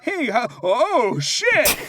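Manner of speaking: slow then high, loud burst of shock; a teacher turning round and seeing blood. Retold or imitated. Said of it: "He goes".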